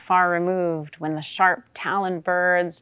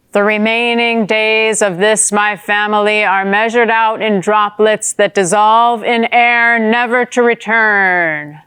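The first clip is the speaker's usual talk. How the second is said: grand, loud oration in a raised pitch; ceremonious fish; 4 semitones higher, 6 dB louder than usual. A narrator's reading. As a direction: declaim it solemnly like a ceremonial address. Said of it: "a somewhat ceremonious style".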